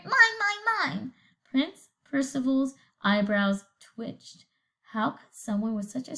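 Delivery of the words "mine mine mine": higher, sharp squawked mines; parrot squawk. Acted. Parrot grabbing at the prince, higher squawk. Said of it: "squawked the parrot".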